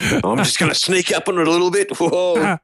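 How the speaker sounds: put-on extreme Australian accent, high-pitched; broad, over-the-top Aussie wildlife-host impression; Steve Irwin. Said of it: "Steve Irwin".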